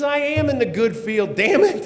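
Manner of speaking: high, slow, emphatic protest; exasperated outburst. Retold or imitated. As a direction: act out a frantic caller arguing back. Frantic caller argues back in retold call.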